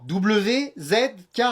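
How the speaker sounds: very slow letter-by-letter correction; slow, separated W, Z, K; slowed dictation. Acted. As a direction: repeat each letter with long gaps. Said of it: "moins vite".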